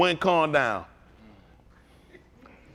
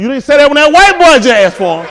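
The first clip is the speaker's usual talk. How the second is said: much louder and higher, a laughing shout; laughing holler; 7 semitones higher, 14 dB louder than usual. Retold or imitated. Calling out the boss at the glove shelf, laughing holler. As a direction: holler it at him through laughter. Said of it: "Oh, I hollered loud to him".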